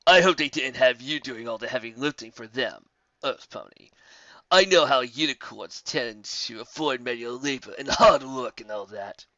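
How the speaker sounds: slow, mildly sneering jab; taunting. Acted. The griffin taunting Twilight about unicorns. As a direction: needle them with mock concern.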